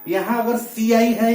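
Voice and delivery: ordinary talk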